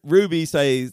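usual speaking voice

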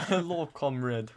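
put-on light Russian-accented greeting. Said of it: "in a Russian accent".

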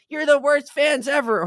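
imitating a young girl scolding rival fans, high and loud; mock little girl. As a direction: squeak it like a scolding seven-year-old. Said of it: "seven-year-old girls".

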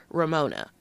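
usual speaking voice

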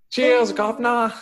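high, loud mock-British customer; hammed-up British cheer; bad British accent. Customer thanking the cook, bad British accent. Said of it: "asking for some bad British accents".

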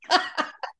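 brief high laugh; a quick real chuckle; short laugh. Real, not acted. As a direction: laugh at her own line. Laughs after diamond earrings line.

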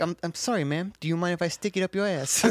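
mock British accent. Retelling a comedian's bit as a British guy, mock British accent. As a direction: do a polite British guy, poorly. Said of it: "like, a British guy saying that".

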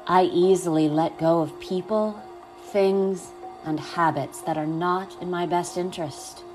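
calm, unhurried guiding voice; guided meditation. Acted.

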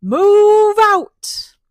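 high, loud rallying yell; queen shouting orders to her colony; commanding shout. Acted. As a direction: command the whole swarm to move. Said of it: "commanded the colony".

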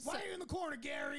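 high-pitched put-on questioning; mock scolding. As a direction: playfully call out an imaginary ghost, voice up. Mock scolding the imaginary ghost.

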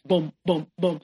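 spaced-out booms like footfalls; short, separate thuds in words; imitated footsteps. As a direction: mimic someone's pretend footsteps. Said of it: "pretending to walk away with footsteps".

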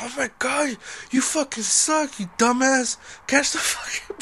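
high, mock frustrated yell that cracks into a laugh; retold screaming at players. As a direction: imitate a drunk friend berating the team. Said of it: "Like, fucking screaming".

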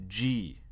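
G modelled alone as "djee"; English G, "djee"; soft D onset. Shown as a demonstration. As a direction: lead into G with a small D. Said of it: "on met un petit D au début".

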